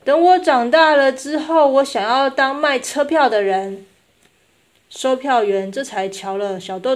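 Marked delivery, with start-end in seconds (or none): 0.0-3.8 put-on high, loud child's declaration; eager small girl, voice pitched up; wistful little girl. A narrator's reading. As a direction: announce a childhood dream out loud. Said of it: "小豆豆依依不舍地".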